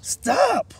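big booming shout; bellowed. Acted. Gorilla shouting after the fleeing mouse, bellowing. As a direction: bellow it like a huge ape. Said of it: "bellowed the gorilla".

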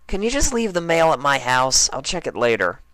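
lazy shout up into the tree as Rainbow Dash; Rainbow Dash calling up. Acted. Dash to Derpy.

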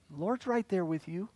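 softly promising; tender reassurance. Acted. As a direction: hush it to comfort them. Tender reassurance after the story.

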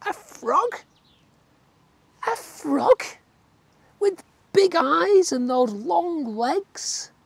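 high, slow incredulous little tadpole; squeaky tadpole, aghast at frogs. A narrator's reading. Tadpole voice in story.

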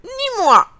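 high, loud duck squawk; quacking duck. Acted. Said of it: "cancana le canard".

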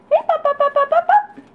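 very high, loud sing-song calling to puppies; puppy calling voice. Real, not acted. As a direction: coax the pups with a squeaky high call.

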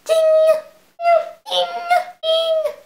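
high ringing game chime; imitated ding. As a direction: mimic a little bell ping. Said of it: "I just love the little dingy sound".

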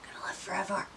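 whispered, hushing. Retold or imitated. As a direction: hush it like a secret. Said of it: "She's like".

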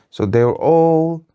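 slow, lilting storybook voice; story narration. A narrator's reading. Parent telling a bedtime story, lilting. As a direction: draw the child in with a sing-song opening.

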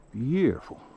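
ordinary delivery

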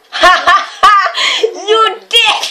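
high, loud fake cackle into a shout; brother's exaggerated imitation of his sister; mocking the sister. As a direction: ham up her gloating laugh. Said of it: "I don't laugh like that".

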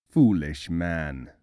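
low, slow royal rebuke; a stern, unhurried king. A narrator's reading.

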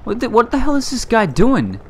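high, loud disbelief; incredulous. Real, not acted. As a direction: exclaim in baffled exasperation. Baffled by game teammate's behaviour.

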